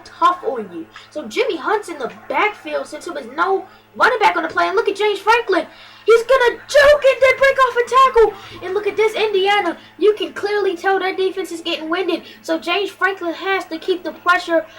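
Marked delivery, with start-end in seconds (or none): 6.1-8.7 excited call. Real, not acted. Calling a big run.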